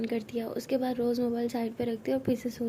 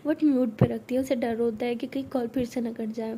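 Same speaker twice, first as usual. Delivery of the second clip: plain reading voice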